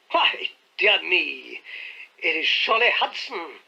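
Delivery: surprised tone. A narrator's reading. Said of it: "in a tone of surprise".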